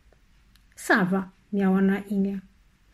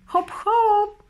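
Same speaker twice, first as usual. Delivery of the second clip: high, ringing call; 12 semitones higher, slower than usual. A narrator's reading. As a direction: call out across the water. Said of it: "zawołał Wars".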